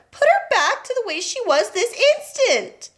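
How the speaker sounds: high, insistent; a mother ordering Wonka; demanding mother. A narrator's reading.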